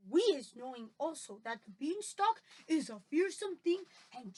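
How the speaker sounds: sing-song giant character voice; BFG, earnest. Acted. BFG tells Sophie about the dreaded beanstalk, earnest giant voice. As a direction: explain solemnly, weighting each odd phrase.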